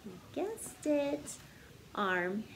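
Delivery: bouncy storybook narration building up the list; story reading. Acted. Teacher reading a silly picture book to kids, bouncy storytelling. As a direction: read it like a picture book to children. Sings-songy refrain, teasing guesses.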